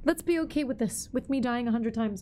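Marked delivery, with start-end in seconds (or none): none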